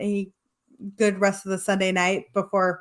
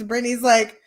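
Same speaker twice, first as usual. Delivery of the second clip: ordinary talk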